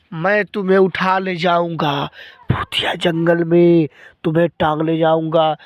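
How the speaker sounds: low menacing ghost voice. Acted. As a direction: growl it like a spooky ghost. Ghost threatens the child.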